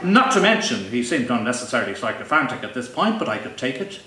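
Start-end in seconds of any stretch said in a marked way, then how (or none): none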